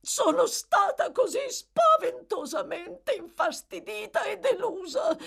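high-pitched, wailing lady in distress; distressed matron. A narrator's reading. Mrs Corney complaining to Bumble, distressed. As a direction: lament in a high, overwrought woman's voice.

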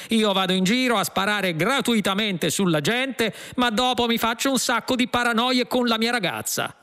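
earnest, touchy cop, pitch climbing as he goes; self-justifying policeman. Acted. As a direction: plead your sensitivity as an aggrieved cop. Policeman justifies himself to the fugitives.

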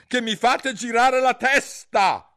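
high, slow, exasperated queen's yell; shout. A narrator's reading. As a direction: yell it, drawing out the words. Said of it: "gridò la regina". (grido esasperato)

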